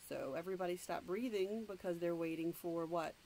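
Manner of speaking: usual speaking voice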